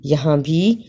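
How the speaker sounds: ordinary delivery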